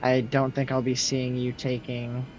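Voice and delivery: ordinary talk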